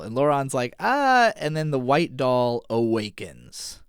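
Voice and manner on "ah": sudden high loud yelp of alarm; a quick high, loud, startled ah. Retold or imitated. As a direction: jolt out a short high startled cry. Laurent jolted by the shaking.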